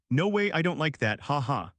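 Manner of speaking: plain reading voice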